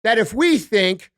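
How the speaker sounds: slow, drawn-out mock politician; mocking speech with heavy, deliberate emphasis; Kamala impression. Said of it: "another woman who talks like this".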